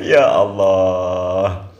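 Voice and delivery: slow, stretched Ya Allah; drawn-out exclamation. Real, not acted. Host reacting to the viral clip, slow stretched disbelief. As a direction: draw it out, shaking your head.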